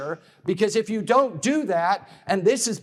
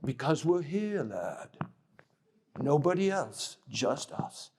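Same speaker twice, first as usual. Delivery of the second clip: put-on quiet, low posh British accent, steady and firm; a sergeant steadying a scared lad in posh British; Sergeant Bourne posh British; 4 semitones lower, 8 dB quieter than usual. Sergeant answers frightened soldier at the wall, quiet posh British accent. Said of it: "That's sort of my posh British accent".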